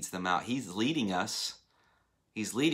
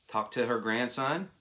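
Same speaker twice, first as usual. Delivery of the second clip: usual speaking voice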